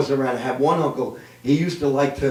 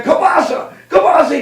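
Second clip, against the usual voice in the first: high, hammed-up Polish outcry; despairing Polish man; 11 semitones higher, 3 dB louder than usual. Polish man finds kielbasa before jumping, despairing high cry. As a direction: cry out like a doomed Polish worker. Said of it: "Polish man".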